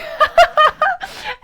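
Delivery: a high, bursting laugh; short high laugh at her own joke; high giggle. Real, not acted. Laughing at own surprise-party joke.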